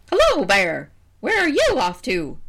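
high, loud cawing bird voice; crow voice. Acted. Crow greets the bear, high and loud. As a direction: play a brash crow.

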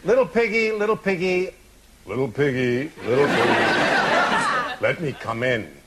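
sweet, wheedling wolf, sinking low; sugary wolf asking nicely; polite wolf voice. Acted. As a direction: coax the pig like a too-nice wolf. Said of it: "his most polite voice".

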